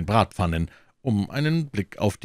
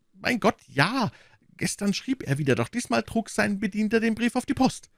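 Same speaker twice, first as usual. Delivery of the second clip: high, fretful innkeeper voice, wringing his hands; anxious innkeeper; 12 semitones higher than usual. Acted. Innkeeper pleading with D'Artagnan, high and anxious. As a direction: play the worried host complaining.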